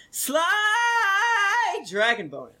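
very high, long held first word; hammed-up battle cry. Host reading a review aloud, hammed-up battle cry. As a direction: stretch SLY out high like a flourish. Reads review with comic flourish.

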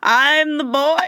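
high, put-on impostor's announcement; loud proclamation. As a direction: proclaim it loudly in a hammy voice. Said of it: "he just loudly will proclaim".

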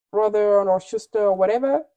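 ordinary delivery

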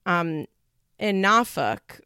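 normal talking voice

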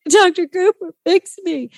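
high, pleading call to the chiropractor. Retold or imitated. Telling the chiropractor to fix her, high pleading. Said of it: "And I was like".

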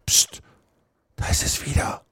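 whispered alarm; urgent whisper. Acted. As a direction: whisper a warning to your companion. Boys hear noises in graveyard.

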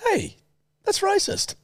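very high-pitched protest; going high. Real, not acted. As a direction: squeak it out in a high voice. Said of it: "why are you going high then".

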